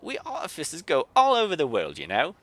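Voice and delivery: a cross Yorkshire artificer grumbling; Yorkshire grumble. Acted. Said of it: "she declared".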